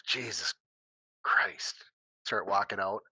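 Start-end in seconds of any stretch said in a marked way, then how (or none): 0.1-1.8 hushed, half-voiced exasperation; muttered oath. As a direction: mutter it to yourself, softly. Acted muttered oath after crash.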